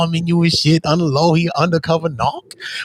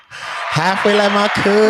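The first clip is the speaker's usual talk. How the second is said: slow drawn-out echo; 7 semitones higher, slower than usual. Shown as a demonstration. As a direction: drag out "Halfway like my cousin" slowly, mocking.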